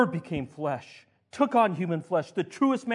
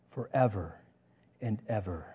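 normal talking voice

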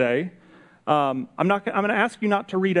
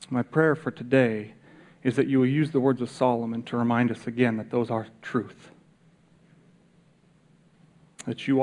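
lower, hushed prayer voice; 4 semitones lower than usual. Acted. Leads the closing prayer.

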